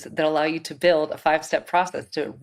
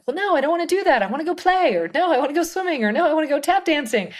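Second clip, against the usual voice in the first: put-on high, bright little-girl whine; childlike inner voice; 11 semitones higher, 4 dB louder than usual. Host voicing her feminine inner voice as a playful kid. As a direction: play the kid inside who wants to play. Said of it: "that feminine voice is like".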